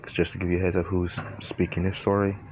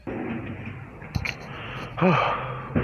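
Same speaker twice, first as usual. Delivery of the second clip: pained sigh; 10 semitones higher, 4 dB quieter than usual. Real, not acted. In pain from hiccups.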